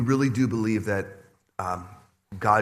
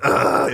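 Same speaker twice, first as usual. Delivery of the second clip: melting sigh; 9 dB louder than usual. Retold or imitated. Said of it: "is good".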